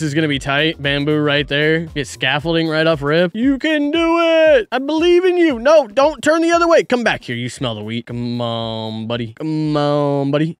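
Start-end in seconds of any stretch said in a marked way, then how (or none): 3.3-7.4 much higher, louder cheering on a pet; root for the little guy like a proud parent; high, sweet pep talk to a cow; encouraging. Real, not acted. Cheering an animal across.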